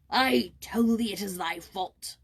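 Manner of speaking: slow, loud angry man; gruff farmer blaming the boy, loudly; angry cry. A narrator's reading. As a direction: cry it out in anger. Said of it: "cried Broussard's angry voice".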